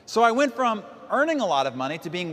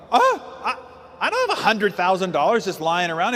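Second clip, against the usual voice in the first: put-on shocked young man. Young man hearing he owes taxes, laughing shock. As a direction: gasp and laugh in disbelief. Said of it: "I'm like".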